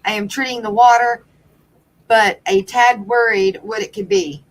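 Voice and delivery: normal talking voice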